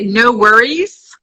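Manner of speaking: lightly Australian "No worries", slow; Aussie phrase. Retold or imitated. Guest showing an Australian saying, slow and light. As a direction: drawl out "No worries" with a hint of Aussie. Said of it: "you can just say".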